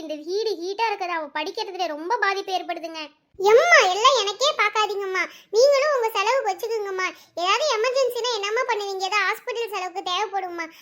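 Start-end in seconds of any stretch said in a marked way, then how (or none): 3.5-10.7 lightly voiced daughter, pleading with parents; concerned young daughter. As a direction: plead as the caring daughter, slightly raised pitch. (பெற்றோரிடம் அக்கறையாகக் கெஞ்சும் மகள்)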